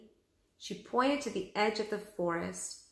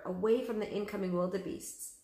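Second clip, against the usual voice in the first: ordinary delivery, nothing marked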